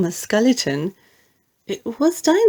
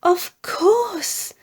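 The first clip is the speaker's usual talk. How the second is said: excited; 9 semitones higher than usual. A narrator's reading. Winnie delighted at discovery.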